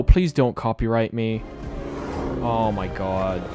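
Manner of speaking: ordinary delivery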